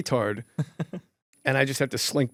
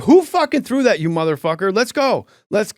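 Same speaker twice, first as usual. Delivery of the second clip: much higher, louder raging shout; furious yell; 8 semitones higher, 6 dB louder than usual. Retold or imitated. Comic yelling into the crowd, ready to fight. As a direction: scream a challenge to fight. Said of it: "You're mad".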